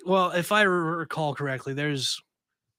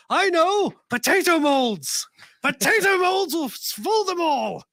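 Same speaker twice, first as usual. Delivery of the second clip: much higher, slow hammed-up English accent, a laugh inside; a giddy mad scientist in a mock English accent; bad English accent; 13 semitones higher, 4 dB louder, slower than usual. Cohost voicing a mad scientist's potato plan in a bad English accent. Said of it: "they have a very bad, um, English accent".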